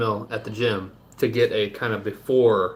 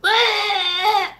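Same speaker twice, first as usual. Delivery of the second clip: a squeaky, much higher goat-like bleat; dying goat wail; 5 dB louder than usual. Retold or imitated. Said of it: "Sounds like a dying goat".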